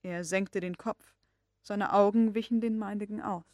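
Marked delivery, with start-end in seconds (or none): none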